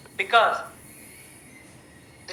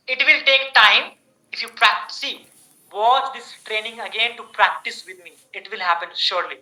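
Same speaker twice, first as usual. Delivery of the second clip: usual speaking voice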